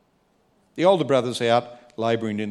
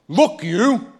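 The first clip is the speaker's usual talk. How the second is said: put-on higher, louder rude child; cheeky child; 8 semitones higher, 6 dB louder than usual. A child answering a parent rudely, higher and louder. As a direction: snap it at your parent. Imagined child talking back.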